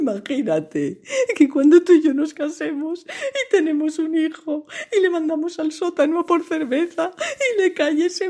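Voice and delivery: high, slowing sobbing lament; tearful Molly. A narrator's reading. Molly telling her fiancé her fear, high wailing sobs. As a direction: wail through tears. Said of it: "las lágrimas se mezclan".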